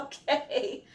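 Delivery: usual speaking voice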